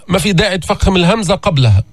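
usual speaking voice

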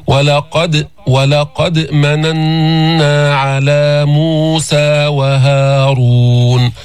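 ولقد with a crisp bounce on the silent dal, no vowel after it; very slow ولقد مننا with a clear bounce on د and a long ghunna on مننا; qalqala on the dal. Shown as a demonstration. Teacher showing students the qalqala in ولقد. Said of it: "ثم تأتي بالقلقلة في هذه الدال".

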